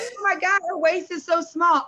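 high, gushing compliment; gushing guest. Retold or imitated. Guest gushing at the wedding, high and excited. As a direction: gush over the bride like a guest.

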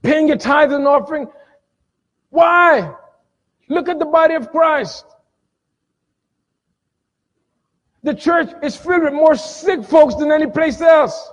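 high, forceful sermon delivery; fired-up preaching. Real, not acted. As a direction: preach it out to the congregation.

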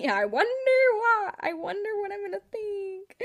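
sarcastic sing-song about obvious crush. Real, not acted. Sarcastic mock wonder.

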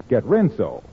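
ordinary talk, nothing marked

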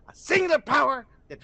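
"singular power" hammed up, loud and high; mock dramatic quote. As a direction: ham up "singular power" like a proud showman. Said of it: "it sounded like that, bro".

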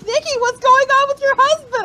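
high-pitched imitation of worried questioning; alarmed friends. Friends asking fan about her favourite wrestler, mock alarm.

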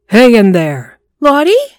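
loud, high urgent call; urgent shout. A narrator's reading. Robert shouting to trapped Lottie, urgent and loud. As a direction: shout to reach her through wood.

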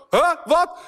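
verbaasd en hoger, vragend. Retold or imitated. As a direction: verward en overvallen. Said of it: "En hij zo". (verbaasd antwoord van de man)

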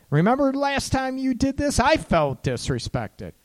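high-pitched mock grievance; tit-for-tat retort. Coach acting out partners trying to one-up each other, mock indignant. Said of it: "get into the tit for tat".

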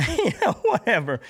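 bursts into high, loud laughter; laughing. Real, not acted. Laughing at how Old Kenny would act, laughing out loud.

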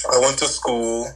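normal talking voice

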